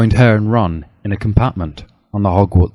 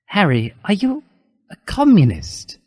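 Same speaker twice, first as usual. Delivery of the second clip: plain reading voice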